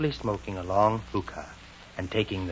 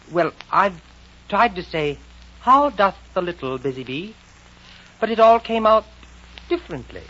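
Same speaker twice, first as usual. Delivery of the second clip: put-on high, louder girl's voice; halting explanation in a raised girlish pitch; Alice; 10 semitones higher, 7 dB louder than usual. Alice voiced in story reading.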